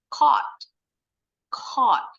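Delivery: caught, clear open O; model pronunciation. Shown as a demonstration.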